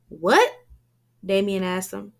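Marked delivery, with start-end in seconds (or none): 0.1-0.7 loud, startled what; 1 shocked what. A narrator's reading. Damien reacting to Shakim's accusation, shocked and loud. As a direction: react in disbelief.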